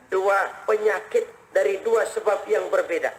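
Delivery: usual speaking voice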